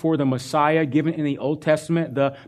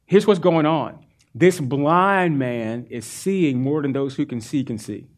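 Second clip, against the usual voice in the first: usual speaking voice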